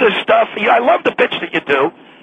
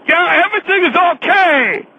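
higher parody Chinese-guest voice; mocking Chinese accent; 6 semitones higher than usual. Caller ridiculing the host's fake guest, mock Chinese accent. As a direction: mimic the bad Chinese voice. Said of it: "You do that Chinaman".

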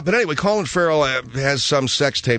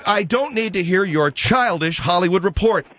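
indignant protest; 6 semitones higher than usual. Acted. Host angrily rejects her gossip report.

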